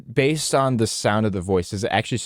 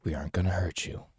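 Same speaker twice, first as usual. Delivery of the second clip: whispering; 5 semitones lower, 9 dB quieter than usual. Acted. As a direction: hush her fears softly. Calming a terrified survivor.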